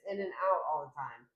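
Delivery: ordinary talk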